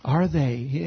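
usual speaking voice

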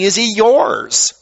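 slow, "yours" drawn out and falling; slow it down and land on "yours"; pointed question, "yours" pushed home; stress on YOURS. Shown as a demonstration. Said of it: "It's asking".

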